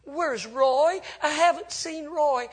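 acted distraught wife, high and loud; imitating a tearful, worried woman; crying wife.

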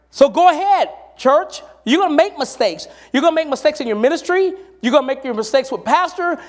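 rally the congregation with rising force; preaching. Acted. Pastor urging the church to keep trying, full-force preaching.

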